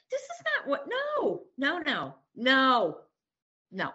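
refusing to believe it, voice up high; distraught denial. Retold or imitated.

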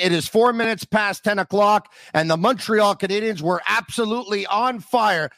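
announcer intro. Acted. Host opening his podcast for Canadiens fans, booming announcer read. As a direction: punch it out like opening a broadcast.

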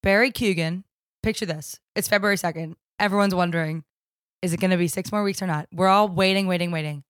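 low, softened storyteller narration; hushed scene-setting. Acted. Friend narrating the groundhog's emergence, low and suspenseful. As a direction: paint the scene with measured suspense.

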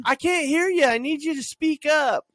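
high, loud mock shout. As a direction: shout up at them, hammed-up. Mocking a muffled listener.

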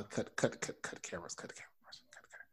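urgent hushed whisper. Acted. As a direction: whisper it so he can't hear. Urgently halting filming.

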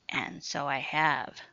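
low, coarse whisper like sandpaper; raspy voice. Acted. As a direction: rasp it low and menacing. Same eerie voice as Sue.

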